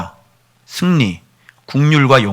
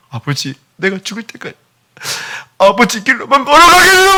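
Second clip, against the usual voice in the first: rising to a much higher, loud pledge; tearful vow; 10 semitones higher, 4 dB louder than usual. Acted. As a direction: swear it through tears.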